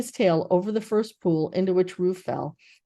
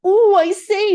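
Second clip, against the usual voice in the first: high, loud flustered ooh; excited squeal; 13 semitones higher, 11 dB louder, slower than usual. A narrator's reading. Piglet squealing while jumping up and down. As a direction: jump about and squeal in excitement. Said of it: "making noises".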